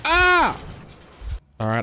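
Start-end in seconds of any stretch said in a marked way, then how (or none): 0.0-0.6 sudden high loud shriek; startled scream. Real, not acted. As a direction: yelp in sudden fright. Sudden fright in game.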